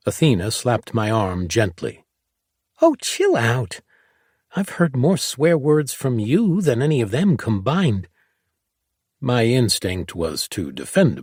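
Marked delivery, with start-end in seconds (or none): 2.8-8.1 put-on a kid telling an adult to relax; teasing girl. A narrator's reading. Girl teasing him about his own swearing.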